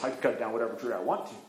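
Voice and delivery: a hint of a gruff woodcutter; dismissive big man. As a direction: shrug the little elf off, big man. Big woodcutter brushes off elf.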